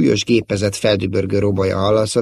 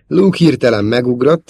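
usual speaking voice

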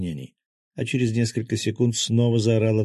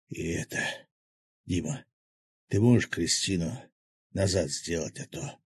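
halting, trailing-off plea from a wounded man; labored, wounded speech; 2 semitones lower, 4 dB quieter than usual. A narrator's reading. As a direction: break it up softly, fading out. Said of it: "прохрипел глава акул".

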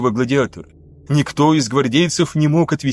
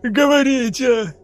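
much higher, slow, panicked; strangled denial; 10 semitones higher, much slower than usual. A narrator's reading. Pinned lieutenant denies betraying them, strangled. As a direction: deny it while being choked.

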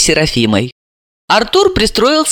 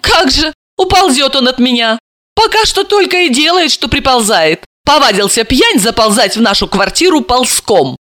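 higher-pitched scornful retort; contemptuous snort; 7 semitones higher than usual. A narrator's reading. Wife scorning her drunken husband, contemptuous. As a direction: scoff at the very idea. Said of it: "презрительно фыркнула".

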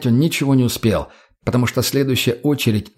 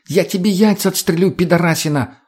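high, angry threat; furious threat; 7 semitones higher than usual. A narrator's reading. Tonya threatens the addict with a pistol, furious. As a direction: threaten in a raised, angry pitch. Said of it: "полной гнева".

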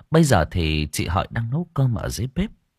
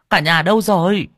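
higher, louder calling through the house; 9 semitones higher, 5 dB louder than usual. Acted. Grandmother calls family out, higher and louder. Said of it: "gọi to".

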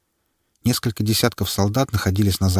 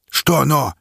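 impatient; 7 semitones higher, 3 dB louder than usual. A narrator's reading. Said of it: "нетерпеливо подогнал его".

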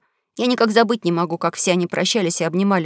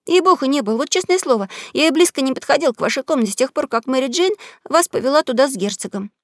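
put-on higher, eager boy protesting; pleading young boy, pitched up; boy's voice; 5 semitones higher than usual. Huck swears innocence.

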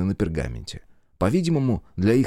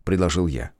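plain reading voice

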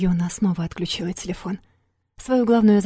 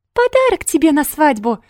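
high squeaky mimicry; mock her in a squeaky voice; 8 semitones higher, 6 dB louder than usual. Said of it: "писклявым голосом передразнил он".

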